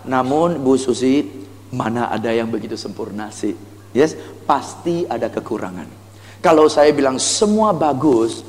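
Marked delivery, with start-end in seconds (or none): 0.1-5.9 low, softened honesty; candid admission. Shown as a demonstration. Salesperson admitting to Bu Susi that flaws exist, candid and low. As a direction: drop down and confide the catch. Confiding demo after gushing pitch.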